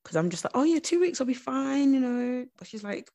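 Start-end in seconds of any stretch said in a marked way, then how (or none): 0.6-2.4 high, airy self-mockery; mock-casual reassurance, pitched right up; breezy dismissal. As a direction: brush it off in a high, carefree lilt. Mimics own breezy dismissal to therapist.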